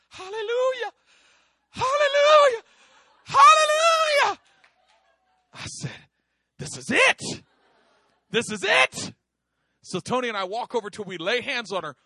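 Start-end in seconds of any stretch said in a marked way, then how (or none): 0.1-4.4 building from high to very high, very loud; swelling joyful cries; shouted praise. Retold or imitated. Woman suddenly bursts into praise. 6.6-9.1 high, loud excited cry; thrilled it's finally happening; rising excited shout. Retold or imitated. Excited at breakthrough.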